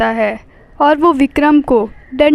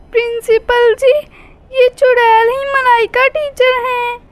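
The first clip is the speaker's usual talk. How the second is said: put-on much higher little girl's voice; 9 semitones higher than usual. Schoolgirl telling the principal the witch is her teacher, high child's voice. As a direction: pipe up like a small schoolgirl. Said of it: "class की एक बच्ची Aiza principal ma'am से बोलती है".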